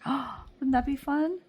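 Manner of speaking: thrilled at the idea; excited gasp. Real, not acted. Dreamy gasp about the fair.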